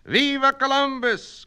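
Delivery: high, loud rallying cry; cry of cheer. Acted. Classmate cheering for Columbus with a loud cry. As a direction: cheer him on at the top of your voice. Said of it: "cried Missus Rodriguez".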